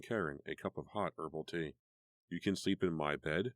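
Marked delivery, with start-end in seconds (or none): none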